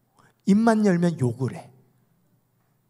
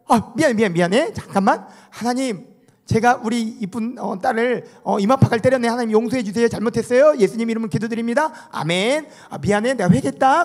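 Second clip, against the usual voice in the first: raised pitch, hurried show of repentance; hammed-up sorry, then a token prayer; mock apologetic prayer; 7 semitones higher, 4 dB louder than usual. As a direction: play a pastor making a glib apology to God. Pastor's hasty mock apology and prayer.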